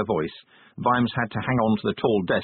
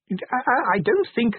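very high, faltering inspector voice; flustered stammer; 14 semitones higher than usual. A narrator's reading. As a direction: stammer a helpless protest. Inspector protests, overwhelmed.

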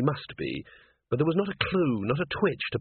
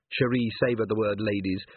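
usual speaking voice

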